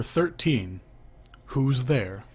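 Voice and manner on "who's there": ordinary talk, nothing marked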